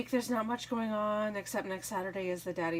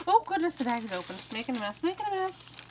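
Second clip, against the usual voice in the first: flustered high-pitched fussing, climbing higher; real mild dismay, pitched up; 7 semitones higher, faster than usual. Real, not acted. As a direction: fuss over a sudden little mess, voice rising. Flustered as the bag spills open.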